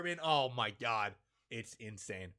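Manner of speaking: ordinary talk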